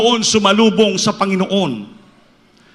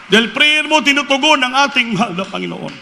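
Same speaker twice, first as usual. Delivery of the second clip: higher, louder exclaiming; excited praise; 8 semitones higher, 4 dB louder than usual. Retold or imitated. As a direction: burst out in grateful praise. Birmoy exclaims to Pastor Almeida.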